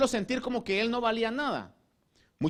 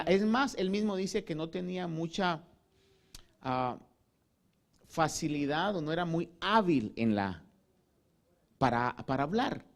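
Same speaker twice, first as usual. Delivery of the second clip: normal talking voice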